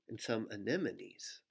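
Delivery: normal talking voice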